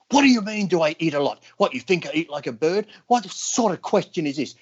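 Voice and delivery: high, outraged shouting at the reporter; imitating an outraged bodybuilder; furious rant. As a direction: throw the reporter out. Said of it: "He just gets fucking flips out".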